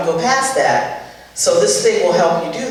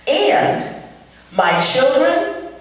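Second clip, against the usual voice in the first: usual speaking voice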